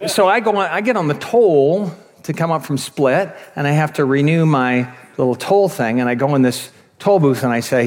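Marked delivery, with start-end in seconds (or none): none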